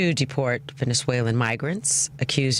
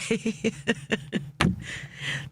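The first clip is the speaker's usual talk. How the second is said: high airy gasping laughter; breathless wheezing laugh; 8 semitones higher, 6 dB quieter than usual. Real, not acted. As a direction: laugh until you can't breathe. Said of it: "I'm hyperventilating".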